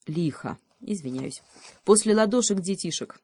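ordinary delivery

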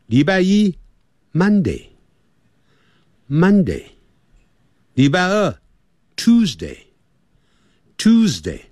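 each day name stretched slow and clear; slow repeat-after-me. Shown as a demonstration. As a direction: drill the days slowly for pupils. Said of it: "我们现在就跟着讲". (慢速领读星期)

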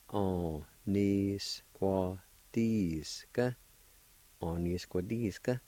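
On-ni-squatisca drawn out very slowly, syllable by syllable; careful, stretched demonstration of On-ni-squatisca; syllable-by-syllable pronunciation. Shown as a demonstration. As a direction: sound out On-ni-squatisca for learners, slowly. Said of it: "it's pronounced like this".

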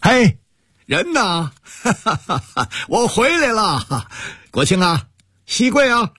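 high ringing call across the courtyard with a laugh; joyful homecoming call. Acted. Uncle arriving home calls out to the household, high and joyful. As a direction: shout the family's names, overjoyed to be home.

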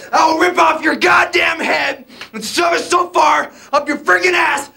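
ordinary talk, nothing marked

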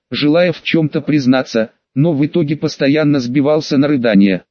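ordinary delivery, nothing marked

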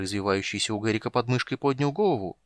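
plain reading voice

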